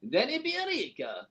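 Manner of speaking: slow, drawn-out mock Spanish accent that slides into Borat; a failed Spanish accent drifting into Borat; Spanish accent sounding like Borat. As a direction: stretch the name in a hammed-up foreign accent. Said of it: "that sounds like Borat again".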